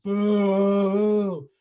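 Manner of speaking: loud drawn-out boo; imitating a crowd booing loudly; mock crowd boo. Crowd booing in retold scene.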